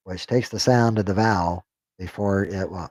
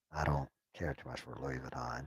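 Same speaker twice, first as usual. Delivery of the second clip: muttered aside; 9 semitones lower, 17 dB quieter than usual. Real, not acted. Muttered aside about brand.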